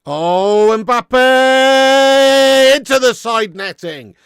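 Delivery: huge high shout, the name held long; live football commentary yell; commentator's shout. Real, not acted. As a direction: roar the player's name like a goal is coming. Live commentary on a near miss.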